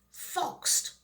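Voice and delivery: breathed out on a sigh, no voice. A narrator's reading. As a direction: let the word escape as a defeated sigh. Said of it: "sighed the witch".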